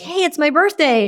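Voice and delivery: excited news. Retold or imitated. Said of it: "So if you're like".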